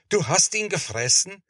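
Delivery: high-pitched appalled question; aghast, pitch jumping up; horrified exclamation. A narrator's reading. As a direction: cry it out in shocked disbelief. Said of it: "rief Bernhard entsetzt".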